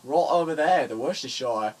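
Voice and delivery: loud, broad mock Worcestershire voice; Worcestershire accent. Telling someone to get a drink, mock Worcestershire accent. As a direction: ham up a Worcestershire local. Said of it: "done in a Worcestershire accent".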